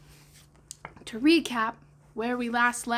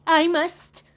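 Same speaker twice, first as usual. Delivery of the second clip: trembling; 7 semitones higher, 9 dB louder than usual. Acted.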